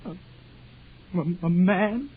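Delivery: choked stammer; stuttering. Acted. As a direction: trip over the word. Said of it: "I stuttered like I always do when I get confused".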